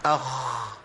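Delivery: اخ with the خ held, breath running out; أخ بخاء ساكنة مهموسة; sukoon kha. Shown as a demonstration. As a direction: say اخ letting air hiss through the خ. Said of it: "هقول ايه؟".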